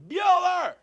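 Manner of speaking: loud, very high-pitched holler; calling the dog. Retold or imitated. Owner leaving the dog park calls his dog, high shout. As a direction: yell the dog's name across the park.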